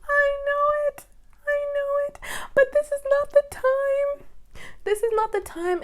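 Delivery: imitating a tearful, squealing prayer; mock crying wail. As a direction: cry out to God, hammed up. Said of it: "I get in the car and I cry and I cry and I cry".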